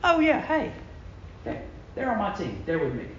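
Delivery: offhand, high-pitched start sinking into a soft aside; casual acknowledgement. Acted. Preacher showing how we picture confessing, offhand and casual. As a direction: casually vouch for them, barely bothered. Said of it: "we hear something like this".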